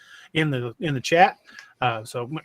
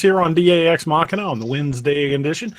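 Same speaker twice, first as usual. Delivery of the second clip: announcer opening; 2 semitones higher, 7 dB louder than usual. Acted. Host greeting viewers at show start, booming announcer voice. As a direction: welcome the audience like a radio host.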